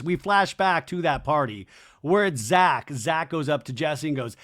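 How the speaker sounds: ordinary delivery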